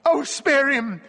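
high, loud plea; imploring. A narrator's reading. Wife imploring her husband to spare him, high and loud. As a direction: beg for his life. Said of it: "Jean-Marie implored".

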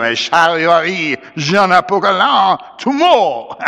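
high, crowing French cry; rally the mob in French; Bienvenu. A narrator's reading. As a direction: Creole leader calling out, rising at the end. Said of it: "cried Bienvenu".

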